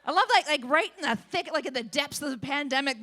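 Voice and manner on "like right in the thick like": ordinary talk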